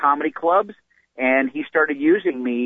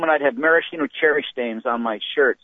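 ordinary delivery

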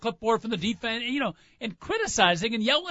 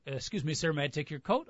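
polite doorman; 4 semitones lower, 8 dB quieter than usual. Retold or imitated. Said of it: "fancy hotel".

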